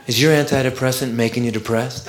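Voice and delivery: low, soft drug-ad voice; commercial voiceover guy. Acted. Voiceover guy asking viewers about antidepressants, low and soothing. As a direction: soothe them like a pharmaceutical announcer. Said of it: "And the voice comes on".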